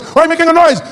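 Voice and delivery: very high, loud scolding; heated imitated parent yell; mock escalating anger. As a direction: repeat it shriller, as a parent losing patience. Angry parent repeats, more heated.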